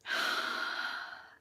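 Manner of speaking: long audible breath out through the mouth; a deep cleansing sigh for the class; demo exhale. Shown as a demonstration. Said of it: "start with three deep breaths".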